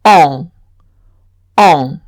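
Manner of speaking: on as a French nasal vowel; on with the n silent. Shown as a demonstration. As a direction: model the nasal on. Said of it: "como un único sonido".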